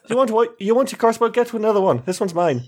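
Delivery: high-pitched, defensive Irish character; Irish accent. Acted. Triss warns others off the weapon, in a light Irish accent. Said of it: "Triss has always been Irish".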